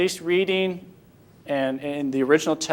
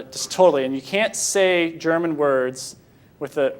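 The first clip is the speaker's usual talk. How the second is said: ordinary talk, nothing marked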